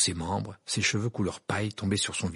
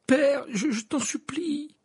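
high, tearful begging; frightened son's high plea; desperate pleading; 4 dB louder, slower than usual. Acted. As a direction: beg him, voice drawn out and faltering. Son begs his judging father.

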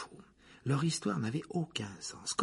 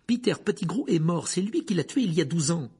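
put-on higher, louder boy hero's retort; Harry accusing; 7 semitones higher, 5 dB louder than usual. A narrator's reading. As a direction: push the accusation up and out. Harry's accusation, voiced by the narrator.

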